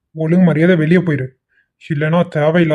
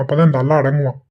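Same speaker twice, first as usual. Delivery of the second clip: ordinary delivery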